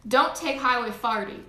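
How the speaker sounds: put-on chatty Saint Louis American accent; playful Midwestern American accent; switched American accent. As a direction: give local directions in a put-on American accent. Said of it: "we're switching accents".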